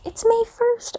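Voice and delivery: high, thrilled squeal of the date; excited. Real, not acted. Vlogger greeting her viewers, squealing with excitement. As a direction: gush it out, delighted it's finally May.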